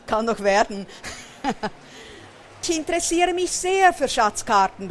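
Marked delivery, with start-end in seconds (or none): 2.6-4.9 high, eager character voice; happy storybook exclamation; delighted call. A narrator's reading. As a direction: pipe up with pleased interest. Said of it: "ruft Berti erfreut".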